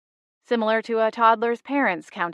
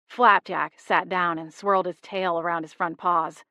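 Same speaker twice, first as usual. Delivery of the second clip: plain reading voice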